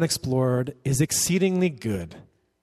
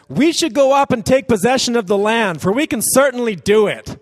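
bold call; 9 semitones higher, 7 dB louder than usual. Acted. Caleb's bold call, voiced.

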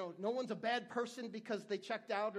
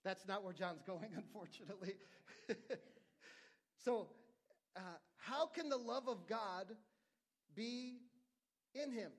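normal talking voice

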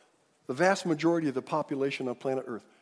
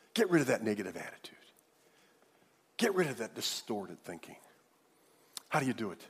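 ordinary delivery

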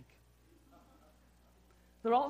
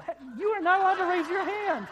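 high, loud mock protest; mock outrage; 10 semitones higher, 11 dB louder than usual. Real, not acted. Preacher mock scolding the congregation member, high and loud. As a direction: cry out in playful shock at the raised hand. Preacher teasing a raised hand.

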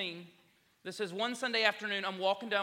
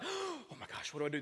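starstruck panic; 8 semitones higher, 4 dB quieter than usual. Retold or imitated. Preacher freezing up as stars approach, mock panic. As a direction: gasp it high, hammed-up fluster.

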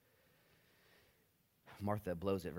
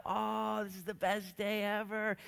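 high dreamy gush; dreamy Mary; 12 semitones higher, 3 dB louder, slower than usual. Retold or imitated. Mary gushing on her happy day, lightly voiced. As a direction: sigh it happily.

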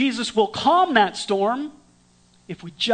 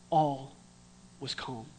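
very soft, falling and lower; hushed awe, much softer; 6 semitones lower, 9 dB quieter than usual. A narrator's reading. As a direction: let the calm settle, barely spoken. Repeated, hushed dramatic ending.

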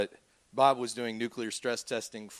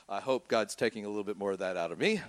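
usual speaking voice

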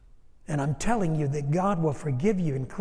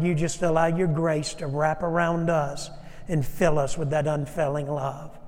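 ordinary talk, nothing marked

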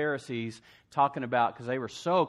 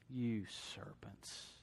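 slow, breathy whisper; menacing whisper; 2 semitones lower, 12 dB quieter, much slower than usual. Acted. Jesus to the Pharisees, a slow, menacing whisper. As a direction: whisper it slowly, like a warning. Jesus quoted calling them serpents.

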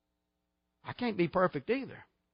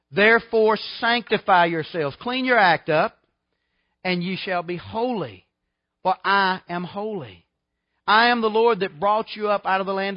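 full-force preaching; 2 semitones higher, 6 dB louder than usual. Acted.

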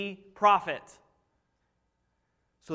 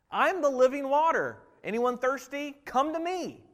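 proclaiming; 7 semitones higher than usual. Acted. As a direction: call out the invitation. Jesus proclaims invitation.

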